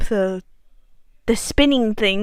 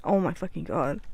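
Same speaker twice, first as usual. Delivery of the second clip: ordinary talk, nothing marked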